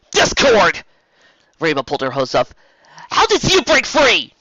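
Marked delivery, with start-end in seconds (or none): 0.1-0.8 high, loud cry of a name; shocked shout. Acted. Rainbow recognizes Discord, a high loud startled cry. 3.1-4.3 high, loud alarmed question; alarmed demand. Acted. Rainbow confronts the escaped Discord, high and loud alarm.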